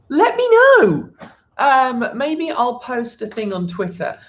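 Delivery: ordinary talk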